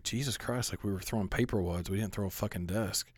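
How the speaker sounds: hushed, murmured gossip; hushed whisper. Retold or imitated. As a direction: mutter it like classmates whispering. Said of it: "They're, like, talking".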